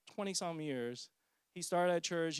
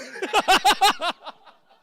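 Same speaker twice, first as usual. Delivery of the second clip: loud high burst of laughing; big loud laugh, higher; loud laughter; 15 dB louder than usual. Real, not acted. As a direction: crack up at your own story. Laughs at waste plant punchline.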